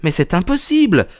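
put-on high-pitched young woman's voice; protesting. A narrator's reading. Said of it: "protesta mademoiselle Hunter". (protestation d'une voix aiguë)

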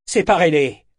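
loud, high, curt command; curt order. A narrator's reading. Captain orders gaping sailors to intervene, curtly. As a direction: bark it as one sharp order. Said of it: "fit-il sèchement".

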